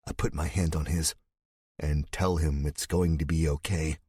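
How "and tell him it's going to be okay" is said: ordinary delivery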